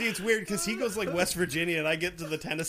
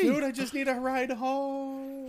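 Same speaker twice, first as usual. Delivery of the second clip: dopey slow voice; 6 semitones higher, slower than usual. Pretend. Voicing the lizard man.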